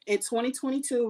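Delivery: normal talking voice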